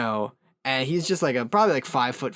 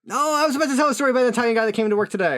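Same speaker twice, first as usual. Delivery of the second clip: fast, high and louder, bursting in; excited interruption; 9 semitones higher, 4 dB louder, much faster than usual. Real, not acted. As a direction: blurt it out fast in delight. Jumps in excitedly, same story.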